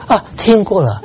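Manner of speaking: put-on high, dismissive child's voice, cutting him short; mocking a child who waves off the sermon; bored kid brushing him off. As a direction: toss it off like a kid who has heard it all before. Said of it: "他说".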